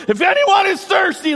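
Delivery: high, loud proclaiming shout; preacher shouting Jesus' invitation; calling out. Acted. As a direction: cry it out to the crowd.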